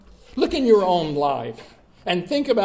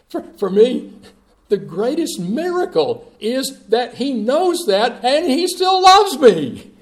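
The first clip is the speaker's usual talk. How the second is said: climbs higher and louder to a laughing peak; rising preaching; 9 semitones higher, 7 dB louder than usual. Acted. Preacher to congregation, swelling into joyful preaching. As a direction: build it up until the joy breaks through.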